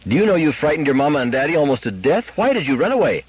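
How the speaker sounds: high, upset mother scolding; worried scolding, pitched well up; anxious anger. A narrator's reading. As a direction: demand an explanation from your runaway child. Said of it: "anxiety dissolving at once into anger".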